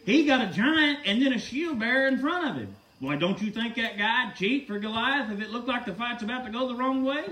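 normal talking voice

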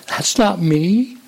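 slow, high, halting amazement; shocked wonder. Acted. Teacup sees itself in the mirror, slow shocked wonder. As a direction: draw it out in astonished disbelief. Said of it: "I was shocked".

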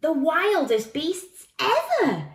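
put-on high, loud, drawn-out child voice; dramatic boy. A narrator's reading. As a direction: stretch it out in wide-eyed warning. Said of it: "said the boy".

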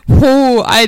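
normal talking voice

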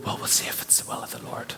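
breathy whispered mock of a timid pious hedge. Pastor mocking a timid church response, in a hushed voice. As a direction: tiptoe around it like a nervous believer. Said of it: "Did we go".

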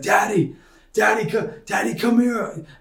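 put-on high, eager little boy calling his dad; toddler voice. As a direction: play a two-year-old tugging at daddy. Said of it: "in his high-pitched, beautiful little voice".

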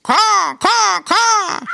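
loud, very high crow squawks; crow cawing. A narrator's reading. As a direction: caw loudly like a crow. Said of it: "it said".

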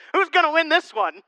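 amused, gleeful, with a laugh; enjoy the fight and laugh through it. Retold or imitated. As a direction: pastor laughing as his dad and aunt argue. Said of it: "sitting at the front laughing".

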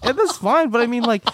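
high, laughing reply. Real, not acted. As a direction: answer while laughing. Laughing while answering.

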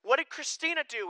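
put-on whiny kid protest. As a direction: whine that it's unfair like a kid. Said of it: "and I would go".

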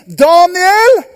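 high, loud call into the den. Acted. The king calls into the den for Daniel, loud. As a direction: shout his name down the pit. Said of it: "begynte han å rope inn".

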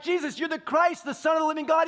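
high, loud mock proclaiming; hammed-up declaration. Peter reminding God what he told Jesus, hammed-up shouting.

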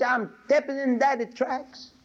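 put-on high, slow child's voice; little boy voice. Preacher voicing the little boy to his drunk father, high child voice. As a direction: sound like a small boy answering his dad. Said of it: "He said".

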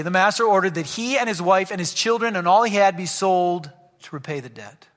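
normal talking voice

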